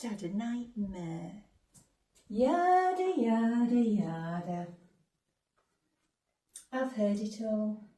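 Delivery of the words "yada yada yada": loud, drawn-out mocking singsong falling in pitch; dismissive yada-yada. Bored ghost mocks parents' stock excuses.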